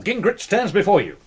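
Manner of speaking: ordinary talk, nothing marked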